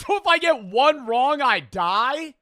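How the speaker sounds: loud, high incredulous question; can't believe what he just heard; shocked disbelief. Real, not acted. Reacting to the quiz host, loud shocked disbelief.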